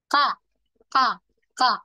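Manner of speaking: crisp repeated ka syllables; ka sounds. Shown as a demonstration. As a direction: tap out ka, ka, ka clearly. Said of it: "Otomatis dia akan ka".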